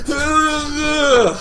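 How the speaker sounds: high, loud strained wail; panicked groan. Retold or imitated. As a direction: groan loudly while losing control. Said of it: "I can't control my foot".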